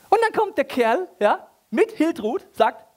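high, loud eager narration; excited build-up. A narrator's reading. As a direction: ramp up the story. (hoch und laut, aufgeregt erzählt)

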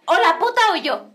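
high, loud mock demand; ultimatum from wife. Señora making him choose, imitated demanding shout. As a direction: hammer out a jealous ultimatum. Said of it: "ya empezó a decir la señora".

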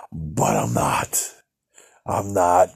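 hushed, slow and soft; whispered. Real, not acted. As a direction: drop to a breathy whisper.

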